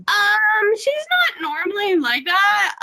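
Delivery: drawn-out high "um", uneasy apology; mock-sheepish explanation for a friend; retold awkward excuse. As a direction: pitch it up like an awkward disclaimer. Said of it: "I was like".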